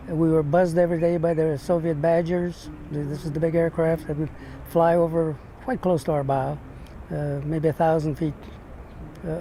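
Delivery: ordinary talk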